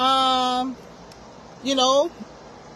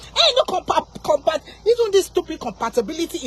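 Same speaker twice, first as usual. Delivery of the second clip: indignant, raised-voice rejection; scornful rebuttal; 2 semitones higher than usual. Real, not acted. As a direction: dismiss the idea with a jump in pitch. Scornfully rejects compatibility argument.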